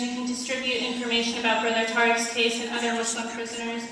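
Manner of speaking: ordinary talk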